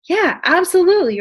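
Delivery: ordinary talk, nothing marked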